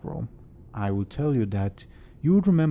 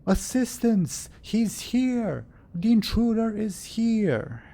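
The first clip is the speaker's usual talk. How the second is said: high, drawn-out cry for help; nobleman yelling; 13 semitones higher, 3 dB louder, slower than usual. Acted. Nobleman summoning help against an intruder, yelling high. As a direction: call the guards in high-pitched alarm. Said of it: "the nobleman is yelling quite loudly".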